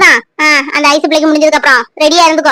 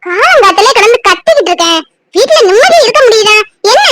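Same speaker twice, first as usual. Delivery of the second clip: exasperated scolding; 6 semitones higher, 4 dB louder than usual. Acted. Mother scolding about shouting since morning.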